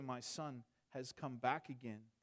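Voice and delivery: soft, slow and low, full of relief; a gentle, slow father's welcome; tender father. Acted. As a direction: cradle the words like a father holding his son. Father's tender words voiced.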